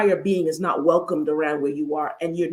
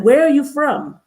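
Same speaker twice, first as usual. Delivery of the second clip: louder, higher mock question; acted blunt curiosity, pitched up and loud; prying stranger; 8 semitones higher, 7 dB louder than usual. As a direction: imitate a nosy runner calling out a question. Voices male runner in video.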